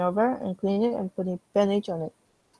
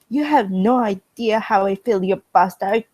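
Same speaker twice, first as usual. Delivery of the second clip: furious yell; 2 semitones higher, 7 dB louder than usual. Acted. China screaming at England after the slap. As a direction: hurl it in his face.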